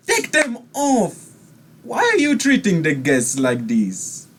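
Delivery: high, loud scolding of a servant; outraged nobleman; indignant command. Acted. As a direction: snap at the guard for the rudeness. NPC scolds the turtle.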